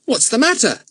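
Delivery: put-on boy startled by a cry. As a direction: jump at the sound. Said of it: "said Jack, startled".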